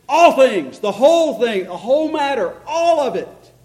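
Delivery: loud, high, punched phrases; emphatic. Acted. Preacher voicing Paul's command to congregation, loud and emphatic.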